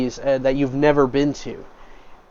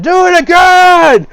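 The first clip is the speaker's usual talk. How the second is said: much higher, louder and slower chant, hammed-up; rowdy cheering patrons; 8 dB louder, slower than usual. Tavern patrons cheer the sliding stranger, high loud chant. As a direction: cheer it like a delighted drunk crowd. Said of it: "are just like".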